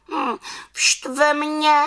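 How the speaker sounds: very high, vexed little witch; high-pitched slow witch grumble. A narrator's reading. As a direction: sulk it out slowly, pitched far up. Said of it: "řekla malá čarodějnice".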